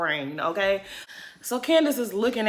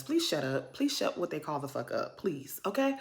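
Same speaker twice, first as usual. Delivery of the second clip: exasperated hush; 5 semitones lower, 12 dB quieter than usual. Real, not acted. Tells Mia to shut up.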